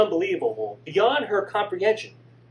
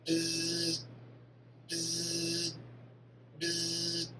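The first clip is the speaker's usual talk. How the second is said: phone buzz; 11 dB quieter, much slower than usual. Acted. Phone vibrates on the table.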